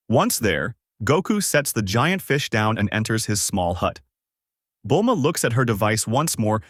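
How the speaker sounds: ordinary delivery, nothing marked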